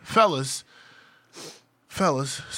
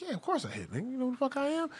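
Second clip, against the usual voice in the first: higher, mock-boastful brag; boastful retort; 13 semitones higher than usual. Bragging to friends back home, a higher mock-boastful tone. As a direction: puff up like you're proud of the hookup. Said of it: "And I was like".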